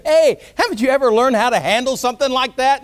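loud, high-pitched scolding; mock outrage at someone's incompetence; condemning. Acted condemning critic.

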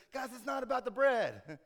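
high, loud, nearly sung cry of frustration; exasperated loud outburst. Acted. Jesus to squabbling disciples, loud and exasperated. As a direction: throw up your hands and belt it out. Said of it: "And Jesus says".

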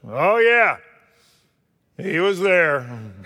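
high, loud, slow knowing wife's voice; the wife drawing out a knowing confirmation; wife chiming in. Retold or imitated. Wife backs up her husband, high and slow. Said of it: "And then Lorraine said".

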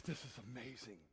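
low, soft, breath-savouring wonder; awed relief. Acted. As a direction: sigh it out in bliss. Savouring each breath after cold.

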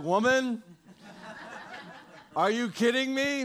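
mock exasperated "Woman, are you kidding me"; impatient son brushing off his mother; exasperated mockery. Shown as a demonstration. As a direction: scoff at her, a hammed-up eye-roll. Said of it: "It wasn't like".

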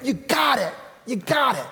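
high, shouted celebration; imagined Jesus cheering Peter; celebrating. Retold or imitated. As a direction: cheer like after a winning play.

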